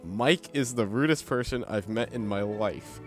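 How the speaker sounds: usual speaking voice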